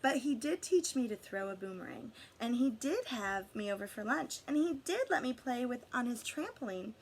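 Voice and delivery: plain reading voice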